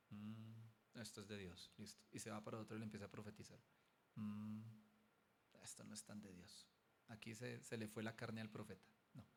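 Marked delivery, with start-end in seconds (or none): 0.1-2.0 much softer, murmured to himself; quiet verdict. Retold or imitated. Pastor judging a prophecy quietly. 4.1-6.7 much softer, murmured to himself; quiet verdict. Retold or imitated. Pastor judging a prophecy quietly.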